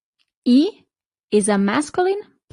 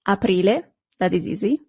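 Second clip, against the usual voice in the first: usual speaking voice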